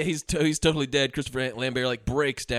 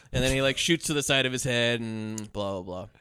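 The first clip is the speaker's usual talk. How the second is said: ordinary talk, nothing marked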